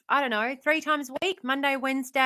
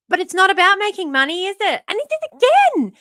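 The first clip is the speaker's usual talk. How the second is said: excited; 9 semitones higher, 9 dB louder than usual. Real, not acted. Indignant rant about unpaid work.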